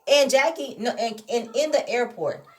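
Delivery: ordinary talk, nothing marked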